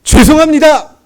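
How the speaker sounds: loud, high yell of "죄송합니다"; shouting an apology to the boss and buyers before leaving; shouted apology. Retold or imitated. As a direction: shout it and bolt from the room. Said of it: "한마디 외쳤습니다".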